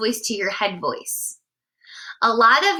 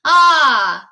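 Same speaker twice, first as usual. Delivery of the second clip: a loud, open "Ah" with all the air through the mouth; 4 semitones higher, 8 dB louder than usual. Shown as a demonstration. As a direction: sing ah straight out of the mouth. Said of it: "all of the air out of your mouth".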